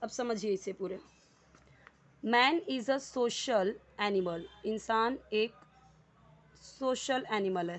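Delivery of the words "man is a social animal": very slow, word by word, each word laid out clearly; slow, careful classroom read-aloud; slow dictation reading. Shown as a demonstration. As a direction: read it out slowly for students to follow. Teacher reading sentence for class.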